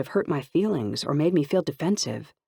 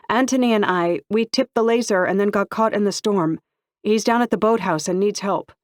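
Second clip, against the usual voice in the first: higher and louder, pressing for help; young woman at the gate intercom urging the guards to go help; urgent; 6 semitones higher, 5 dB louder than usual. Acted. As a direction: blurt it out, the friend needs rescue now. Urgent plea for rescue help.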